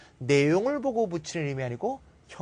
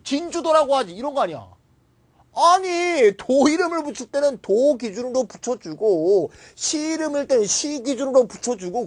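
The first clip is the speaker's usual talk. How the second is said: exasperated rant; 7 semitones higher, 4 dB louder than usual. Real, not acted. Teacher keeps ranting at textbooks.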